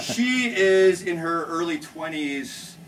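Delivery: usual speaking voice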